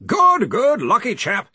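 high, loud, hearty yell. Acted. Arch-Chancellor cutting off a distant researcher, yelling. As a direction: yell cheerily straight over him. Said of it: "Ridcully yelled".

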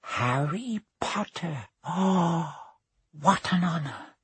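lightly high, slow and reverent; awed old bartender. Acted. Bartender meeting Harry, lightly voiced and awed. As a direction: savour the name.

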